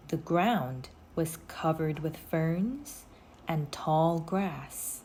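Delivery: usual speaking voice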